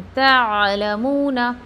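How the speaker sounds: तअलमून slow, the ta merged into a clear ain; careful recited तअलमून; tajweed demo. Shown as a demonstration. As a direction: draw out तअलमून with a throaty ain. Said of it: "ता को आइन में मर्ज करना है".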